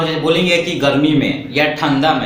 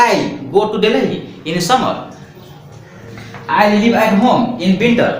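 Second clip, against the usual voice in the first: slow dictation; 4 semitones higher, much slower than usual. Shown as a demonstration. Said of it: "teacher dictates example sentence".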